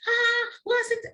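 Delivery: mock panicked yell, high; retold scream. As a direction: shriek it like a startled father. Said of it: "the father was screaming".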